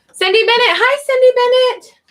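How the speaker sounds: high, loud, bright hello to a viewer; light up as a viewer arrives; excited greeting. Real, not acted. Streamer greeting a newly arrived viewer, excited.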